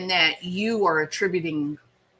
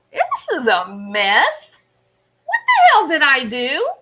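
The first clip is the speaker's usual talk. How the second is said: louder, rising disbelief at her own mess; mock shocked outburst; 6 semitones higher, 8 dB louder than usual. Mother sees the mess she made, mock shocked outburst. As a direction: blurt it out, caught off guard by the damage. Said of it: "Critters looks around and she goes".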